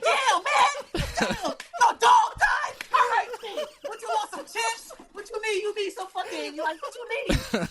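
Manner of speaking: squeaky hammed-up buddy laughing through it; high, loud mock friend voice. Friend reacting to the dead dog, mock high laughing shout. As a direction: play the clueless friend offering chips, high and loud. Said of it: "And be like".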